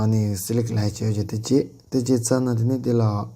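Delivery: normal talking voice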